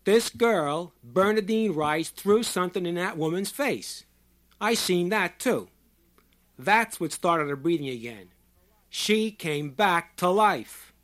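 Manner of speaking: loud, high proclamation to a crowd; emphatic shouting. A narrator's reading. Short man naming the healer to everyone, emphatic shout. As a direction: shout it out like a witness testifying. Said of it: "he shouted slowly and emphatically".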